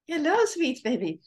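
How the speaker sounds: high cooing hello to a pet. Real, not acted. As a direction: coo sweetly at the kitten. Greeting new kitten on camera.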